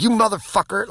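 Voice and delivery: high-pitched retold curse; cussing. Surfer cursing at the waves, mock angry. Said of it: "I'm literally cussing at the waves".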